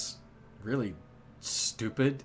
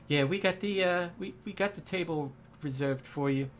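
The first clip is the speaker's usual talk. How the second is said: friendly waitress; 7 semitones higher than usual. Acted. GM voices the waitress.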